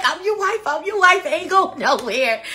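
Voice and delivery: high, playful and giddy. Retold or imitated. Bride-to-be repeating vows to him, giddy and high. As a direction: tease it out with a grin. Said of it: "I'm like".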